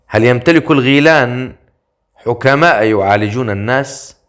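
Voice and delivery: plain reading voice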